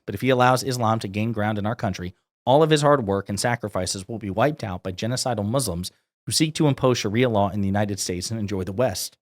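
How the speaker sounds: normal talking voice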